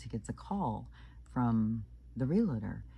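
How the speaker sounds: normal talking voice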